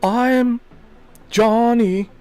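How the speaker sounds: mock Keanu doing a bad British accent, pitch jumping up; hammed-up posh British accent; terrible British accent. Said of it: "with a terrible British accent".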